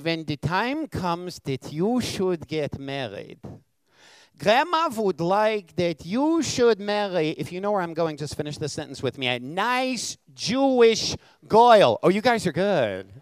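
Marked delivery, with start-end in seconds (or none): 0.0-7.3 put-on slow, drawn-out Polish old-country accent; Polish grandma accent. Grandma telling grandson who to marry, Polish accent. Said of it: "in her accent from that true old country". 9.3-12.0 put-on very slow, louder, higher Polish accent punchline; Polish grandma accent. Polish grandma finishing her request, thick accent. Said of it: "in her accent from that true old country".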